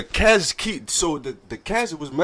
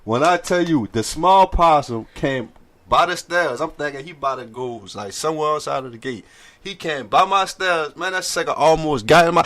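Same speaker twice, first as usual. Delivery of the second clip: ordinary talk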